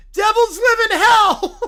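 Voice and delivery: slow, loud, horrified cry; hammed-up terror shout; panicked yell. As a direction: yell it like doom is coming. Kids panic about Devils.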